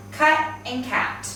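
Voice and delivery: "cut and cat" slowly, uh then short A; slow, careful minimal pair "cut and cat"; vowel contrast. Shown as a demonstration. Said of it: "these sound very different".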